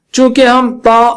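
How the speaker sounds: ordinary talk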